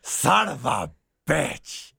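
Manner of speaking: very slow, drawn-out boss growl; Vince McMahon impression. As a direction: drag every word out like the wrestling chairman. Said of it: "we were doing Vince McMahon".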